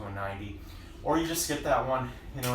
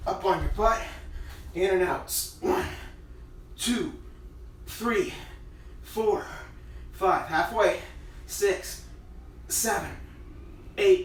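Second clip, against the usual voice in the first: counting workout reps; 7 semitones higher, slower than usual. Acted. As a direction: push out each count mid-crunch. Counting reps while exercising.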